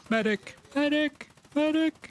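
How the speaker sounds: shouting for a medic, high and loud; man down shout. Real, not acted. Calling for medic in combat.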